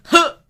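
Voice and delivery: single short joyless laugh; a flat, mirthless huh; mirthless chuckle. Retold or imitated. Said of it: "chuckle mirthlessly".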